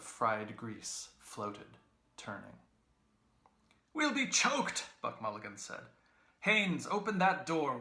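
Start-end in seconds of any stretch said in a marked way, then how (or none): 4.0-4.9 high, loud cry of protest; loud complaint. A narrator's reading. Mulligan complaining loudly. 6.4-7.8 high, loud call across the room. A narrator's reading.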